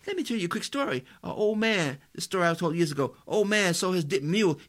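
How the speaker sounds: high, fast preaching tale; excited sermon story; preacher storytelling. Real, not acted. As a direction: tell it to the congregation.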